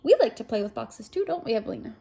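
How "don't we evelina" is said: plain reading voice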